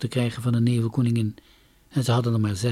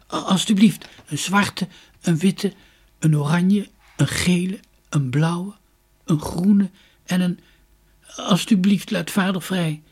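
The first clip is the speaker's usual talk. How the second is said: hoarse plea; 7 semitones higher, 6 dB louder than usual. A narrator's reading. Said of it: "riep hij schor".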